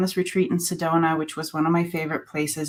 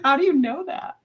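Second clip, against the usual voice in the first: much higher, amazed question; 8 semitones higher than usual. Retold or imitated. Friend reads gibberish, amazed. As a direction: sound floored. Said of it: "I'm like".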